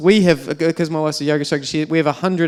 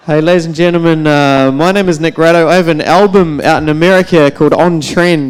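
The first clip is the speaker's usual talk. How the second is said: louder announcer-style closing; show sign-off; 6 dB louder than usual. Real, not acted. Signing off to the audience, a loud announcer sign-off. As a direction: sell the album to the whole room.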